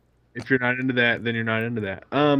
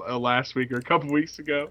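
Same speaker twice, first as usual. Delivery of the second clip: ordinary delivery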